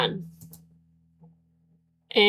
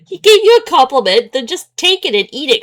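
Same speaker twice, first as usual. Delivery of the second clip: higher, louder, fed-up scolding; exasperated rant; 5 semitones higher, 6 dB louder than usual. Real, not acted. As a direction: tell the character off in frustration.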